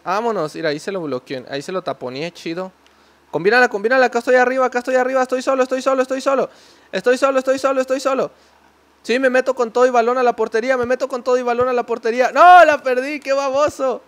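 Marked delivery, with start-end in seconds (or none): 3.3-12.3 high, loud urgent calls; shouting for the ball. Real, not acted. Calling for a pass. 12.3-14.0 high, very loud dismay; frustrated shout. Real, not acted. Loses the ball.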